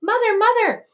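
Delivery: high, loud excited shout. Acted. Charlie bursts through the door shouting for his mother, high and loud. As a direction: burst in calling for her.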